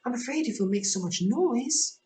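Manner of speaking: Alice afraid of waking the King; Alice hushing. Acted. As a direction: hush the twins like a worried girl. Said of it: "couldn't help saying".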